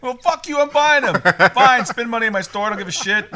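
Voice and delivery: loud, high-pitched hammed-up rant; comic shouted indignation; mock outrage. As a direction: blow up at a customer, playfully exaggerated. Acting out the annoyed store owner.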